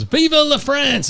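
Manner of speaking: high, drawn-out cry; mock patriotic yell. Joke's Frenchman yelling as he runs into the sword, mock yell. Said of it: "he yells".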